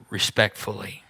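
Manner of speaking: ordinary talk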